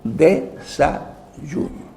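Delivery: Desayuno drawn out very slowly, syllable by syllable; slow down and break Desayuno apart like a teacher; teacherly slow word breakdown; word spelled out slowly. Shown as a demonstration. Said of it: "Vamos a descomponer la palabra".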